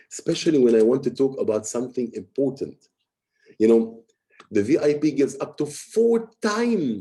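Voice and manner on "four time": "four time" drawn out and punched; emphasis on FOUR TIME. Shown as a demonstration. Coach showing how to emphasize a word, stress on "four time". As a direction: hit "four time" hard and stretch it. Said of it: "sometimes you need to emphasize a word".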